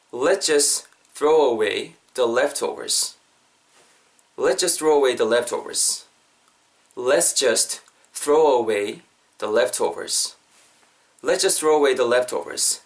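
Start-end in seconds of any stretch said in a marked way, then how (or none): 0.1-3.2 slow, phrase by phrase with pauses; slow model sentence. Shown as a demonstration. Teacher modelling the key sentence for learners, slow and chunked. 7.0-10.4 very slow, broken into phrases; slow model sentence. Shown as a demonstration. Teacher modelling the key sentence for learners, slow and chunked.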